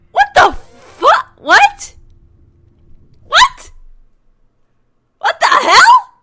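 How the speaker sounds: loud, high disbelieving yells; rising cries of what the hell; shocked outburst. Real, not acted. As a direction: blurt it out in total shock. Streamer shocked by game twist.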